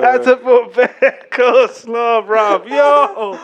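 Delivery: high, loud, slow laughing; wheezing with laughter. Real, not acted. Laughing at the coleslaw.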